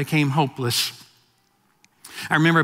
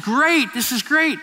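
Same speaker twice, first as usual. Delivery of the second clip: high, eager naive joy; a bright, clueless great; mock excited; 8 semitones higher, slower than usual. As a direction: gush like you know nothing. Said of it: "I said".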